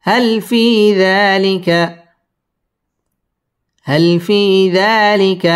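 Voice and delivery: drawn-out chant; slow recitation. Shown as a demonstration. Teacher recites the phrase slowly. Model reading.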